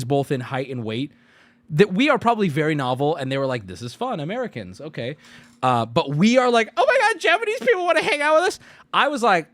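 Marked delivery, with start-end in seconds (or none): 3.7-5.2 low, much softer amused voice; a hint of a bemused local. 6.8-8.6 high, thrilled gush; excited. Retold or imitated. Thrilled Americans.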